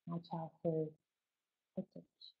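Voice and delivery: soft, guarded admission trailing to near silence; hushed disclosure. Shown as a demonstration. Parent disclosing how their child was conceived, hushed and tentative. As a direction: drop the voice as if slightly ashamed. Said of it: "Does the tone of your voice change".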